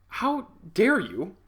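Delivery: drawn-out offended how dare you, high and slow; slow indignant. Retold or imitated. To his manager, slow mock-offended outrage. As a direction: stretch it out, wounded pride.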